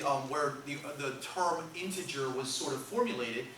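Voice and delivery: ordinary talk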